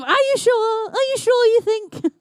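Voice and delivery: high mock heckle, plain level; mock heckler cry. Commentator mocking a doubting heckler with a high cry. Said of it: "A cry of".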